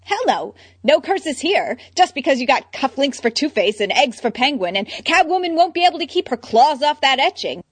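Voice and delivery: high, loud flustered denial. Acted. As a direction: deny it too fast.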